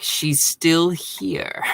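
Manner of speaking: very slow, drawn-out mock disbelief; slow imitation of an incredulous alien; imitated alien reaction. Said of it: "basically is like".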